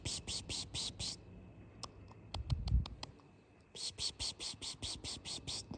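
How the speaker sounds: rapid, soft, voiceless "psst" calls; cat-calling hiss. Real, not acted. Woman luring kittens to look at her, cat-calling psst. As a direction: coax a shy cat with little hisses.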